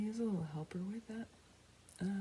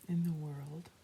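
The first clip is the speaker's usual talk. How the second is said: whispering. Real, not acted. Soft whispered meditation intro.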